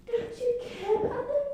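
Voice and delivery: mock servant. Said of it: "one of her lady servants said".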